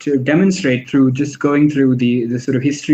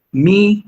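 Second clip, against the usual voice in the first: me raised and pressed hard; stress on me; 6 semitones higher than usual. Shown as a demonstration. Lecturer isolating the stressed word me. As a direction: pitch up on me. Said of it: "the stress that Shakespeare wants you to have is me".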